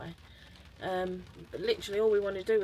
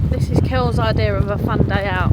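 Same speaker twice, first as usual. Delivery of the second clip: raised voice in gale; loud over wind; 3 semitones higher, 13 dB louder than usual. Real, not acted. Talking loudly in strong wind.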